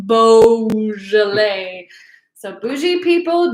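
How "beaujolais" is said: Beaujolais with a hugely stretched "boh"; correct pronunciation. Shown as a demonstration. Host reminds everyone how to pronounce it, drawn-out Beaujolais. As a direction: draw Beaujolais out slowly as booooh-zhuh-lay. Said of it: "to know to pronounce it".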